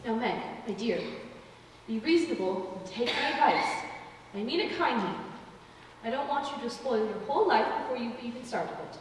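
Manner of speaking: soft, low coaxing advice. Acted. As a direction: soften to win her over. Aunt softens to persuade.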